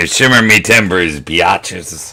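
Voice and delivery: loud, high hammy pirate. Pretend. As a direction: ham it up like a rowdy pirate. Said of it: "why Brugg sound like a pirate".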